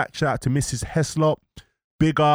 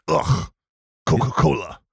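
mock Portuguese accent; disgusted grunt, then Portuguese-accented English; Cristiano Ronaldo. Host retelling the bottle being moved, in mock Portuguese accent. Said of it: "he talks English with a mad accent".

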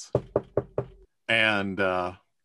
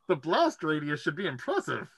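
Brewer voice; 5 semitones higher, 3 dB quieter than usual. Acted. Brewer answers.

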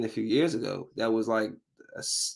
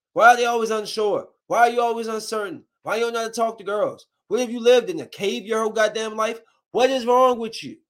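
much higher and louder, incredulous; exasperated rant; 10 semitones higher, 4 dB louder than usual. Real, not acted. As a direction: berate the wimpy characters. Ranting at TV characters.

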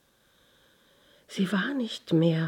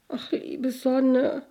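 put-on slow, high, plaintive child's plea; tearful; 8 semitones higher, slower than usual. A narrator's reading. Girl to the dear sun, starting to weep. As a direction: plead slowly in a raised voice, close to tears. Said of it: "begann zu weinen".

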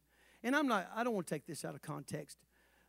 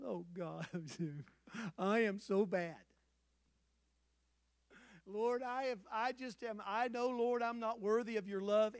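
softer, half-hearted moaning to God; mock self-pitying prayer; 4 dB quieter than usual. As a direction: ham up the pity party a little. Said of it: "I just get into that sometimes".

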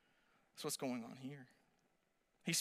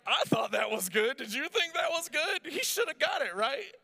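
mock fishing for approval; 10 semitones higher, 8 dB louder than usual. Parents fishing for approval, high.

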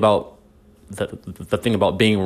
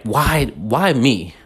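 self-pitying why me; 3 semitones higher, much slower than usual. Retold or imitated. Acting out self-pity when wronged.

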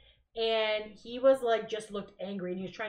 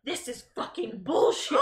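retold angry outburst; 5 dB louder, slower than usual. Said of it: "Scott was like".

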